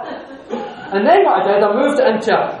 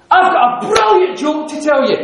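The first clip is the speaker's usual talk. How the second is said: raised, higher voice, loudly announcing a joke; really loud announcement; 6 semitones higher, 5 dB louder than usual. Retold or imitated. Grabbing the attention of arguing people, really loud. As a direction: belt it out to grab the room. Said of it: "I said it really loud".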